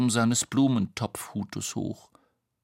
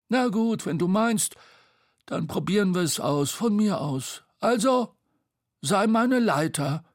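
high, loud character voice; Bo, giving in; 10 semitones higher, 4 dB louder than usual. Acted. Bo agrees to try the climb, high and resolute. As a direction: give in, then take charge in a high voice. Story figure Bo speaking.

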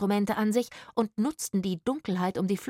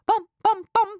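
loud, high "bom" knocks; mimicked knocking; 10 dB louder than usual. A narrator's reading. Woman mocking the dead knocking, high loud imitation. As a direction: imitate thumps on a coffin.

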